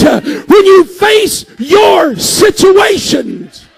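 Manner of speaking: loud, high-pitched shouted preaching; preaching at full force. Acted. Preacher shouting to his congregation, full-force preaching. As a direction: whoop it out to the whole congregation.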